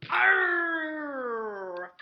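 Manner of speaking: a loud, gruff pirate growl. Pretend. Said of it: "I wanna hear the pirate voice".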